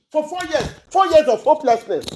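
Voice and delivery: loud, furious outburst; angry shout. Real, not acted. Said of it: "I'm angry now".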